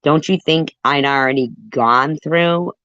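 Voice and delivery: ordinary talk, nothing marked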